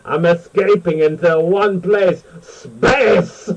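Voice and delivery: slow, theatrical mock Tim Curry accent. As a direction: exaggerate the accent and blurt out SPACE. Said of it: "in this amazing accent".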